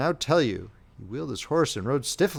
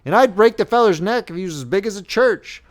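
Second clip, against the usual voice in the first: loud, bold boast; stout declaration; 7 semitones higher, 9 dB louder than usual. A narrator's reading. Friend defending their honour, stoutly loud. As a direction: puff up and declare it hotly. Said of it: "declared the friend stoutly".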